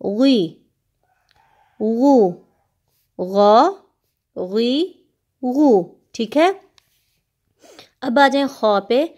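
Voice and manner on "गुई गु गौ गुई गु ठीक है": गुई गु गौ drilled thick with rounded lips; heavy rounded syllables. Shown as a demonstration. Teacher drilling pupils in heavy rounded syllables. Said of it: "दोनों को गोल करें तो लफ्ज़ आपका मोटा निकलेगा".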